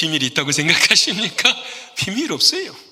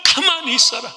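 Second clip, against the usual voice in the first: Moses commanding; 4 semitones higher than usual. Retold or imitated. Moses commanding the people at the sea, booming. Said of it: "백성들 향해 이렇게 말합니다".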